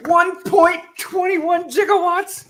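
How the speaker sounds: high, slow, astonished movie-scientist cry; hammed-up panic over the gigawatts; Doc Brown quote. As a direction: shriek it out like a shocked mad scientist.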